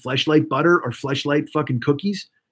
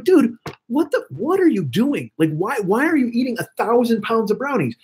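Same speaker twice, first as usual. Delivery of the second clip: incredulous self-scolding; 6 semitones higher than usual. Retold or imitated. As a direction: take yourself to task in a high, stretched-out voice. Scolding himself over the brownies.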